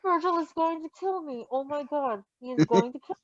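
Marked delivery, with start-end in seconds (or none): none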